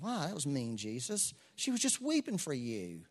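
lightly voiced reproach, rising high; a hint of a scolding complaint; mock indignation. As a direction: tease the reader's outrage. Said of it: "At first you read that like".

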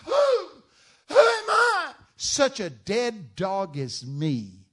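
loud, high anguished cry, then slow; hammed-up despairing outcry. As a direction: wail it from the dirt. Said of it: "buried his face in the dirt and cried out".

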